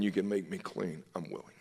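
ordinary talk

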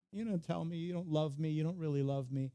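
lightly voiced pleading whine; softly acted mock-hurt nagging; Delilah. Delilah asking Samson again for his secret, light mock whine. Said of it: "just constantly crying and wailing".

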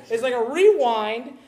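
slow mock rewind with a rising end; rewind sound effect. Shown as a demonstration. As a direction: stretch it slowly like tape rewinding. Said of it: "You like how I did that?".

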